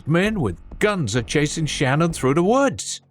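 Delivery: high, frantic yell for help; screaming. Acted. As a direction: scream it at the roommate. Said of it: "I screamed at the top of my lungs".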